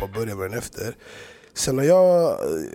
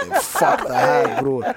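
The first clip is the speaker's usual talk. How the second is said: laughing panic; 4 dB louder, slower than usual. Retold or imitated. Cows charge at him.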